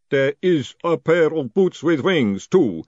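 loud, slow, deliberate golem; helpful golem. Acted. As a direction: announce it as a ponderous golem.

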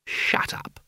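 whispered, tight order to be silent; glaring, voiceless command; menacing hush. Acted. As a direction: silence him with a hissed whisper. Albert glares and silences him.

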